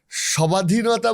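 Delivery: সবাধীনতা with the bo-phola said out, sobadhinota; wrong pronunciation. Shown as a demonstration. Host showing a spelling-based mispronunciation, b sounded. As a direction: pronounce সবাধীনতা letter by letter, sounding the b. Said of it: "বলি না".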